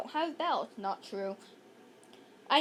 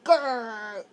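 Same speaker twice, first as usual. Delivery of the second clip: low rumbling bear growl; 4 semitones lower, 4 dB louder than usual. Acted. Bear growling again at the children, low growl. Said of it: "growled the bear".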